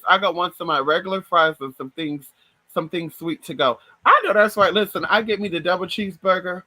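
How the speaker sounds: usual speaking voice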